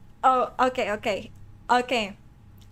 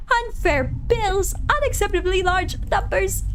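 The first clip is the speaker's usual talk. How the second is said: high, loud, slow furious boss bellow; boss yelling; 8 semitones higher, 5 dB louder than usual. Acted. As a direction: roar the numbers like an outraged boss. Boss yelling in his office.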